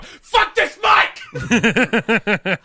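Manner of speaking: sudden exasperated mock yell, drawn out. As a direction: break off and holler it, hammed up. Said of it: "I just yelled the rest of my bit".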